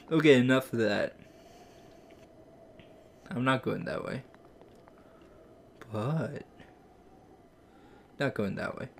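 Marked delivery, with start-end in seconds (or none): none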